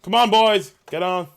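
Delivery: loud, high battle cry; rally the boys forward; rallying shout. Acted. Officer rallying troops in game.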